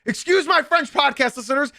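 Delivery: normal talking voice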